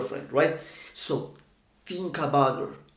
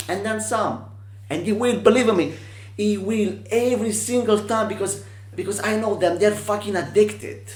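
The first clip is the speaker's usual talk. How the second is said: higher, insistent hype; excited, emphatic hustler talk; fired-up sales pitch; 6 semitones higher than usual. Acted. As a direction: sell it with rising fervour. Fired-up pitch about addicted clients.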